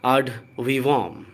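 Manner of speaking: "ad vivum" very slow: "aad VEE-vum"; drawn-out model "ad vivum", each syllable spaced; correct pronunciation. Shown as a demonstration. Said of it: "इसका correct pronunciation है". ("ad vivum" बहुत धीरे, "आड वीवम")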